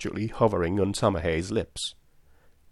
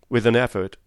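usual speaking voice

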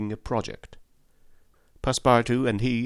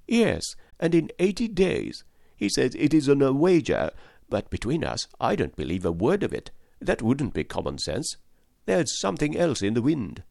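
higher, eager chattering servant voice; excitable French valet; 6 semitones higher than usual. A narrator's reading. Valet telling Fix of Paris, eager higher voice. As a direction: gush like a young valet abroad.

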